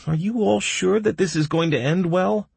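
high-pitched worried question; fretful doctor, voice way up; anxious. A narrator's reading. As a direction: ask nervously, seeking reassurance. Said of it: "anxiously glancing down".

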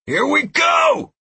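slow, very high, loud battle yell. A narrator's reading. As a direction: hurl it out as the charge begins. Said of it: "I yelled".

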